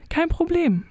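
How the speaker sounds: deutlich höher, zuversichtlich und munter; hoffnungsfroh. A narrator's reading. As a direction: die Freundin sagt fröhlich Hilfe zu. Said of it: "entgegnete meine Freundin hoffnungsfroh". (hoch und hoffnungsfroh)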